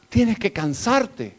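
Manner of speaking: drawn out, word by word; slow insistence. Acted. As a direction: lay each word down slowly.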